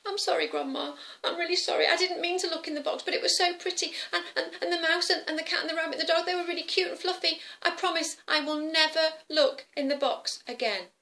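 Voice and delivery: put-on high, hurried child pleading; apologetic little girl. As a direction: babble excuses like a sorry little girl.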